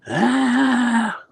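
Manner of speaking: very high, loud mock scream; imitated scream. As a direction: let out a long piercing imitation wail. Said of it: "So he stopped screaming".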